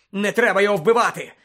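loud, high, urgent protest; breathless urgency. Acted. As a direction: cry out urgently to stop them. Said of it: "сказав він задихано".

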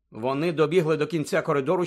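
ordinary delivery

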